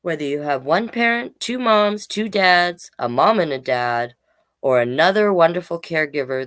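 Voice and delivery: put-on reading to children. Reads picture book to children.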